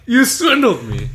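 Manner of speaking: high, loud laughing accusation. Retold or imitated. Fooled by Mister Rogers, laughing. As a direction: accuse him while cracking up. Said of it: "I'm like".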